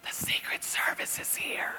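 slow, weighty stage whisper. Retold or imitated. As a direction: whisper the secret slowly into the phone. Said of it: "she answers the phone whispering".

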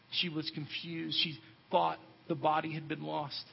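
ordinary talk, nothing marked

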